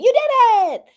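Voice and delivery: high, loud mock cheer; playful cheering as the viewer's words; excited quote. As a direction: read out a chat message like a victory cry. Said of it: "he's also saying".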